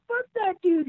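high, sneering gripe; mock angry grumble. Band guys griping in the truck, high mock-angry. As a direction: vent like a pissed-off kid.

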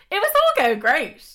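high, loud sing-song gush; hammed-up delight. Real, not acted. Telling how well the fling went, in loud high sing-song. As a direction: belt it out like it's too good to last.

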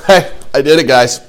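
hammed-up proud shout to the guys; dumb bragging. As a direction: crow about it like a clueless winner. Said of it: "How dumb would I look if I went".

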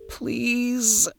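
high, drawn-out plea; begging. Acted. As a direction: beg desperately. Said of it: "Danny begged".

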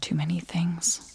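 hushed, slow whisper. Acted. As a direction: breathe it like a secret. Whispered mole secrets.

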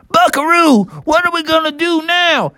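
very high little-bear cry; excitable Bubby. Acted. Bubby at the river asking Buckaroo, urgent. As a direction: squeal the question to your mount.

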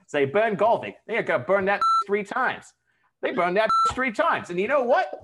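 put-on high, loud New York accent turning Australian; accent slipping into Australian. Podcaster telling how Golvig was burned, loud wandering Jersey-to-Australian accent. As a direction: let the mock Jersey accent slide into broad Australian. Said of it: "and then you turned Australian".

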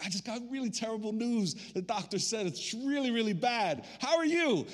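high, hammed-up chirpy delivery; mock cheerful. Mimes rejoicing over terrible news.

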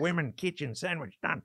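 terse caricature of a macho Aussie; masculine Aussie. Retold or imitated. Comic mocking Colin's regressive views, macho Australian accent. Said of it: "very, like, masculine".

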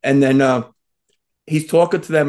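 ordinary delivery

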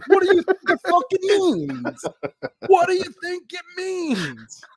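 high stretched-out yell with a laugh; mock yell. Son yelling at dad about the rule, high laughing rant. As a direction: drag it out at him, exasperated.

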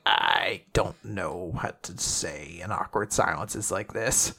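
low, soft, halting awkwardness; awkward. Retold or imitated. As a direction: mumble it low, at a loss for words. Retold awkward silence, character at a loss.

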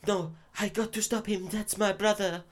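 high, put-on voice for the heroine; odd woman's voice. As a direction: mimic the film heroine's strange way of talking. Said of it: "she speaks like that".

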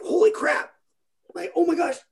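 high-pitched shock, reliving the fall; sudden high alarmed "holy crap"; panicked gasp. Retold or imitated. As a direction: jump way up in pitch at the scare. Dad watching his daughter fall.

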